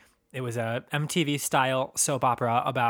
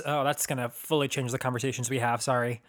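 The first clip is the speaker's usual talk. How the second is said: ordinary talk, nothing marked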